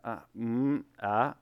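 stammering uh and mm with no words; awkward stalling noises; fumbling hesitation. Shown as a demonstration. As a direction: show someone lost for an opening line. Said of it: "You don't wanna go and go".

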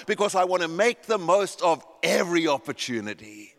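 loud, high, driving sermon voice; impassioned preaching. Real, not acted. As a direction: preach it at full force. Preaching at full force.

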